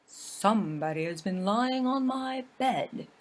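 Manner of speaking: a big bear's gruff growl. Acted. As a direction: growl it like a big father bear. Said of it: "growled in his great gruff voice".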